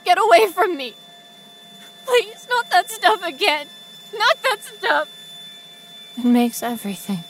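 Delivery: terrified pleading. Acted. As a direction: fight off the needles with your voice.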